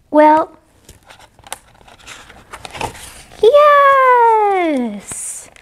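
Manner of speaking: drawn-out high happy yes; delighted yes. Acted. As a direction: stretch the yes up gladly. Animals happily say yes.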